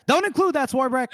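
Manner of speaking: high, loud aside to the scribe; urgent calling out. Acted. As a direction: call it out sharply to the note-taker. Casca calls out to his notary.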